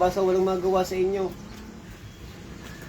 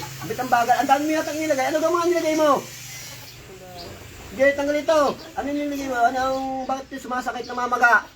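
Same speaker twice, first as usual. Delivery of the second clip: raised, higher-pitched exasperated questioning; scolding interrogation; 5 semitones higher than usual. Real, not acted. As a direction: take them to task with mounting insistence. Healer scolds spirit over harm done.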